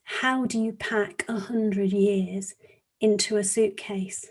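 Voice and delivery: slow, soft, weighty storytelling; hushed story narration. Acted. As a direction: let each phrase hang, quietly, for a listening class. Old man's words within narrated story.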